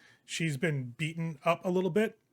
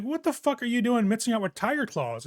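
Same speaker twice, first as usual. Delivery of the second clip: incredulous demand; 7 semitones higher, 4 dB louder than usual. Acted. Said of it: "She's like".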